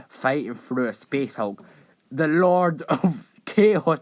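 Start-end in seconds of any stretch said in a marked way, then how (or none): 2.1-4.0 laughing announcement. Acted. Laughs while mock-announcing Abaddon.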